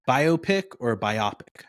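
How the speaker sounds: biopic both ways, first bio-pic, then bi-OP-ic; two rival pronunciations. Shown as a demonstration. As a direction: contrast "biopic" as BY-oh-pick and by-OP-ick. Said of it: "Do you say, uh".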